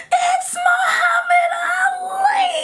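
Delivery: high, loud shriek with a cry in it; screaming. Retold or imitated. Little girl in a restaurant recognizing a legend, screaming. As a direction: scream his name across the restaurant. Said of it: "screaming at the top of her lungs".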